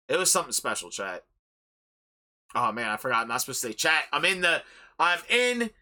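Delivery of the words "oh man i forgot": ordinary talk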